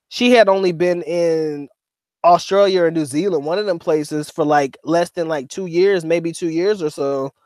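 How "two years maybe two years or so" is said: ordinary delivery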